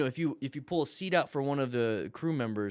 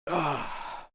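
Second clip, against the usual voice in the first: ordinary talk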